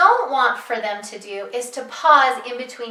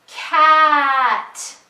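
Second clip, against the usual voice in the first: drawn-out sounds, louder, without a break; stretched slow blend; 3 semitones higher, 7 dB louder than usual. Shown as a demonstration. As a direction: stretch every sound but keep them joined. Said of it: "Listen like this".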